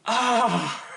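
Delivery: sudden high yelp of effort; short pushing grunt-cry; mock strained cry. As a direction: imitate the strain from the next stall. Stall sound heard from the toilet.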